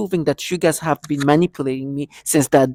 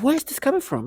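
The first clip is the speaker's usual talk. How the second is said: mock surprise; 12 semitones higher than usual. Perps voiced, high.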